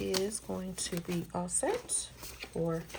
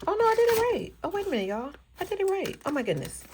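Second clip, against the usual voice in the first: high, loud surprised exclaim; surprised relief; 12 semitones higher, 8 dB louder, faster than usual. Real, not acted. Realizing to viewers she counted right, surprised and loud. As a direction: burst out in sudden relief.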